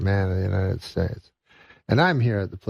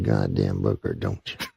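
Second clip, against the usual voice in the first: lightly voiced bully leaning on the shopkeeper; 13 semitones lower than usual. Redneck demands the book again.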